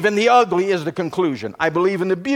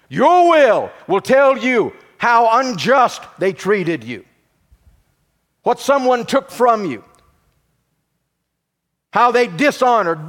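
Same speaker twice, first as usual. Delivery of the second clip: higher, louder preaching build, hammering each line; full-force preaching; 5 semitones higher, 3 dB louder than usual. Acted. Pastor driving the point home to congregation, full-force preaching. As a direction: preach it out, rising to the peak.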